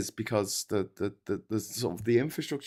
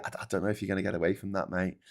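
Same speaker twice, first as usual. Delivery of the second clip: ordinary talk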